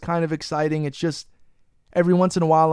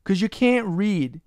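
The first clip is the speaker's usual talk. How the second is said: "can't" leaned on, whole line slow; slow stress on can't; 5 semitones higher, much slower than usual. Real, not acted. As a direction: drag out "'Cause you can't read" to rub it in. Berating cohost who can't read.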